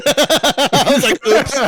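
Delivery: high, drawn-out words through laughter; cracking up while talking; laughing. Real, not acted. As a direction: giggle helplessly through the admission. Laughs at own mistake.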